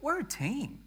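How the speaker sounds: rally the congregation like a team captain; preaching at full force. Acted. Pastor voicing the church's team spirit, preached loud. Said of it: "where we say".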